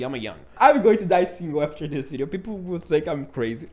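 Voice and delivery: ordinary delivery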